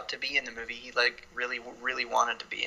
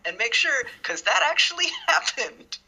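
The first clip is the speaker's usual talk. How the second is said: high-pitched hammed-up character quote; playful imitation breaking into laughter; mock character voice; 12 semitones higher, 3 dB louder than usual. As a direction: raise the pitch, laughing mid-line. Acting out Waltz's character.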